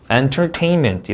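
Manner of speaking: "entertainment" slow, stress on TAIN; careful word stress. Shown as a demonstration. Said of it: "강세 유의해야 될 것들이". ("entertainment"의 TAIN에 강세)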